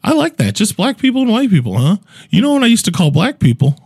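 high-pitched old lady impression; girlfriend's grandma. Grandma chatting to him at dinner, high imitated voice. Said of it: "And she's like".

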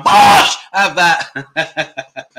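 a cheeky laughing yell of his catchphrase; laughing catchphrase shout. Acted.

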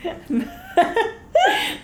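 shrieking burst of laughter. Real, not acted. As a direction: let out a high shriek of laughter. Big laugh after joke.

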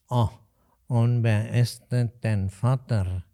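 put-on old innkeeper's drawn-out Hessian drawl; slow nasal Alsfeld dialect. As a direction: stretch each word slowly through the nose. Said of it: "nasale Aussprache und ganz langsam".

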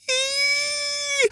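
very high shrill squeal; excited fangirl squeal; fan squeal. Retold or imitated. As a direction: squeal like a delighted kid. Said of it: "There really are a bunch of E's".

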